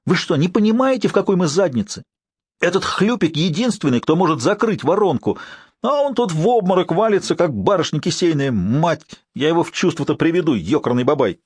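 exasperated shouting rant; angry ranting. Acted. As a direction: rage at the old man. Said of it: "заорал он так".

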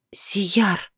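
soft whispered gasp of a name; breathed out. A narrator's reading. Woman breathes the man's name as he touches her, whispered. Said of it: "выдохнула девушка".

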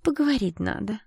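lightly threatening sweetness; sweetly menacing. A narrator's reading. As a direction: greet them sweetly with menace underneath. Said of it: "с ласковой угрозой в голосе".

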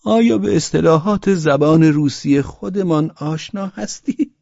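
put-on high-pitched drunkard's challenge; high, provoking drunk question; drunken taunt. A narrator's reading. Drunk man taunts before cursing.

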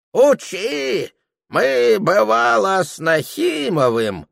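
put-on high, dismissive old soldier; old veteran voice. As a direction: scoff at the warning like a veteran. Old veteran brushes warning aside.

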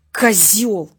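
loud, spiteful outburst; angry insult. A narrator's reading. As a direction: throw it out as an insult. Furious girl kicks her attacker. (злое ругательство, громко)

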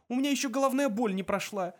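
high, aggrieved wailing protest; frantic complaint; panicked cry. A narrator's reading. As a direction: keep crying out, pitched well up. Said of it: "закричал Артур".

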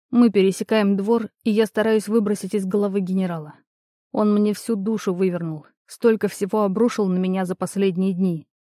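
usual speaking voice

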